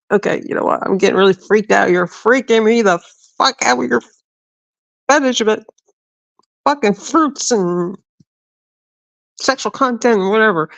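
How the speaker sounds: mock-appalled protest; retold freaked-out rant. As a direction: act out being weirded out. Retold freaked-out telling-off of mom.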